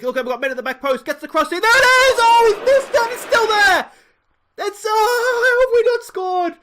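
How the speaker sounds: high, loud, yelling in disbelief; excited shout. Real, not acted. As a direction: roar at the near miss. Near miss, shouting at screen.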